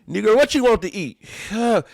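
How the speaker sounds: high-pitched mock impatience; impatient. Wife to the man at home, no patience. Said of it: "No patience, nothing".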